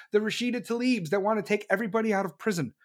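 normal talking voice

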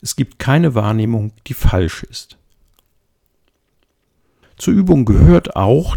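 usual speaking voice

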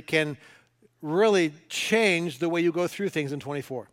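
ordinary talk

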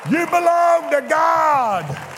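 high, loud, slowing shouted preaching; climactic preaching. Acted. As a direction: command them to stand up and declare.

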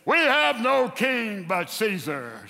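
slow, loud, drawn-out proclamation; loud declaration. Retold or imitated. Pharisees reject Jesus before Pilate, loud slow acted declaration. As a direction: declare it forcefully, stretching each word. Leaders shouting allegiance.

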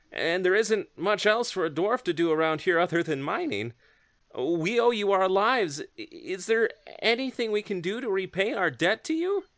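lightly voiced gruff dwarf; a hint of a dwarf miner. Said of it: "Ragnir walks up to you and says".

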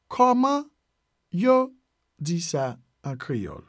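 "Kòman", pause, "yo", pause, then slowly "di sa an Kreyòl"; broken into parts. Shown as a demonstration. Teacher teaching how to ask in Creole, broken into parts. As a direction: dictate it piece by piece. Teacher breaks phrase into words.

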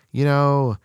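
ordinary talk, nothing marked

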